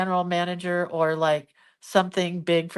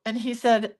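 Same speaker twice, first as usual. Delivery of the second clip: usual speaking voice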